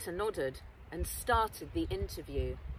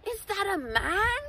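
high, startled disbelief; astonished; 11 semitones higher, 4 dB louder than usual. Acted. Reporter watching a man rush at soldiers, stunned disbelief. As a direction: gasp it out in disbelief, much higher.

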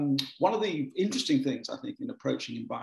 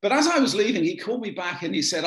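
normal talking voice

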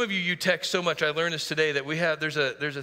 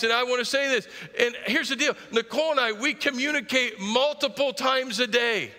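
normal talking voice

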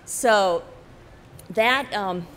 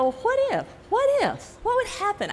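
excited wondering; 13 semitones higher than usual. Retold or imitated. Inner thought voiced excitedly.

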